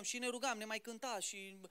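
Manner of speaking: usual speaking voice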